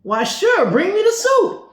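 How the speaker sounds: thrilled yes to free soup; eager excitement. Retold or imitated. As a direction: jump at the offer. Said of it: "And I was like".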